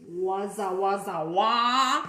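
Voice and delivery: loud excited babble; excited gibberish. Acted. Pigeon spotting the bus, loud gleeful babble. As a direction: babble with glee.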